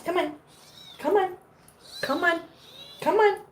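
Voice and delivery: high-pitched pet-calling voice; coaxing. Real, not acted. Owner coaxing her pet to come on, coaxing. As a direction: coax a pet in short high calls.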